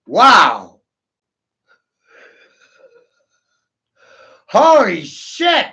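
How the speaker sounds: big, shouted reaction; loud exclamation. Real, not acted. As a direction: blurt it out very loudly, drawn out. Loud exclamations after grim remark.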